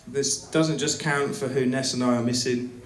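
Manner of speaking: ordinary talk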